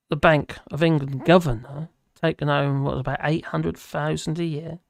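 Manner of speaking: usual speaking voice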